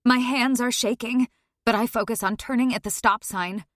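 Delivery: plain reading voice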